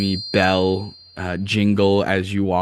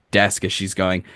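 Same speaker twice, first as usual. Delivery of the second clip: normal talking voice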